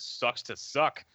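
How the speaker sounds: slow, smug brush-off; smug dismissive drawl. Retold or imitated. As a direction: let it drip with smugness. Dismissive Galen quote.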